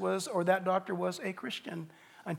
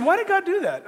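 animated question; 12 semitones higher, 10 dB louder than usual. Retold or imitated. Patient asks pastor, animated.

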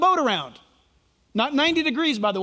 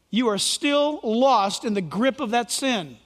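usual speaking voice

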